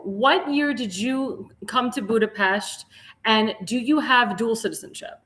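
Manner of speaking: normal talking voice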